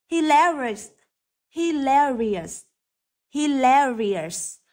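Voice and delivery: Hilarious with stress on LAIR; slow, careful Hilarious, leaning on the LAR; stress on second syllable. Shown as a demonstration. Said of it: "trọng âm rơi vào âm thứ hai". (nhấn âm thứ hai "lair" trong Hilarious)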